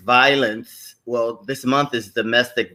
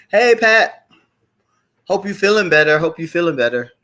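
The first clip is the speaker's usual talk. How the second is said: imitating Tanisha; 5 semitones higher than usual. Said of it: "And then Tanisha".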